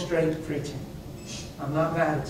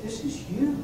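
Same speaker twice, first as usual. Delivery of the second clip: soft prayer; 3 semitones higher, 8 dB quieter than usual. Retold or imitated. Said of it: "and I said".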